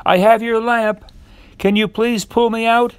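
high-pitched calling out; shouted. A narrator's reading.

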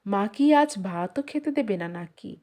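ordinary delivery, nothing marked

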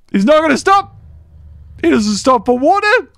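rising panic on stop; panicked cry. Real, not acted. Dinosaur keeps chasing.

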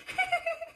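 quick high-pitched giggle; short squeaky laugh. Real, not acted. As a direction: burst out laughing. After the whine.

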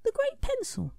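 very high small questioning voice; a tiny curious character asking. A narrator's reading. Scribble asking Smudge about the great pencil, very high.

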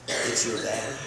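low, soft daddy reply; a hint of a humble father at the cave mouth. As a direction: answer gently as the old father. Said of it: "father answers at cave mouth".

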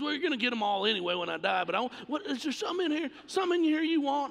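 put-on an old man pushing gifts on his son; eager dad. Emotional father urging his son to pick something, fast, rising pitch. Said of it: "He goes".

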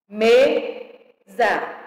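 "Mesa" in two spaced beats, me-sa; syllable by syllable. Shown as a demonstration. Teacher separating words for pupils, syllable by syllable. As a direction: split "Mesa" with a gap between syllables. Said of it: "separação de palavras e sílabas".

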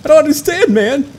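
high, baffled whine of confusion; confused self-imitation. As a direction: play yourself totally lost and baffled. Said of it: "And I'm like".

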